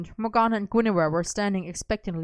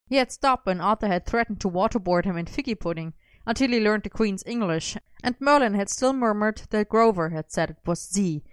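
plain reading voice

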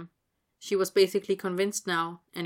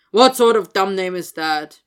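much louder, scornful sneer at a dumb name; scornful scoff; 12 dB louder than usual. Acted. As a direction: bark out contempt. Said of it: "Vernon scoffed".

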